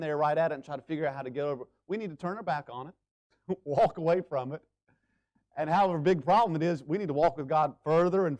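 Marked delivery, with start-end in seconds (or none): none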